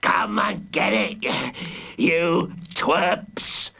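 slow, rough monster voice; villain hollering. A narrator's reading. The Hooded Fang taunting the children attacking him, hollering. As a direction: holler it slowly as a growly villain. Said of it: "he hollered".

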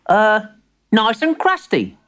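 put-on high child's voice guessing at a list; high, tentative storybook boy; hesitant young grandson. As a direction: read out an order as a small boy. Plays young Timbertwig.

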